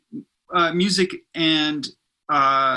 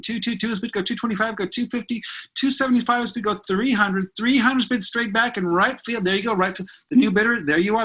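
rapid auction chant, raised pitch; auctioneer; 4 semitones higher than usual. Pretend. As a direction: call bids like a live auction. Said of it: "do, like, a little auction sounding fake ad right now".

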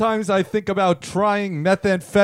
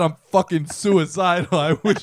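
ordinary delivery

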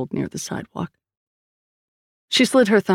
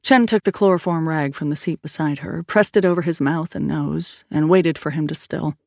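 ordinary delivery

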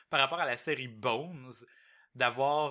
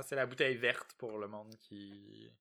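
ordinary talk

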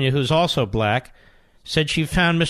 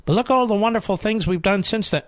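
normal talking voice

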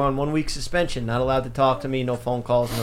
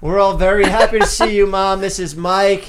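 higher and louder cheerful hello; excited greeting; 6 semitones higher, 6 dB louder than usual. Real, not acted. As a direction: talk up to Mom on the call. Greeting Mom on the video call.

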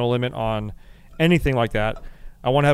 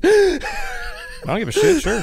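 laughing; much slower than usual. Real, not acted. Laughing at cohost's joke.

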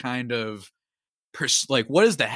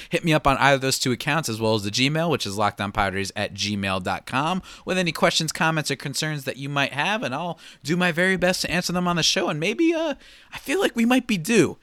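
usual speaking voice

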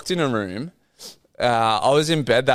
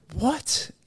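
shocked what; 13 semitones higher, 5 dB quieter than usual. Retold or imitated. Retelling shock at manager's call.

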